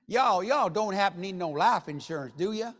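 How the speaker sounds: hammed-up Southern sales pitch; Southern accent. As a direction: lay on a folksy Southern accent. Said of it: "my best Southern accent".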